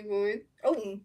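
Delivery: lightly voiced broadcaster calling the at-bat; mock play-by-play. Fan calling the game live to stream viewers, mock broadcaster. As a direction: narrate each pitch like a TV booth.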